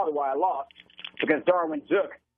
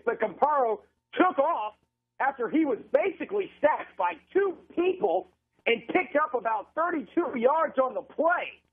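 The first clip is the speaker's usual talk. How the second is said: pitched-up radio call of a big run; excited play-by-play; 7 semitones higher than usual. Real, not acted. Announcer calls a quarterback breaking tackles upfield, excited play-by-play. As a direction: follow the runner live, voice lifting as he breaks free.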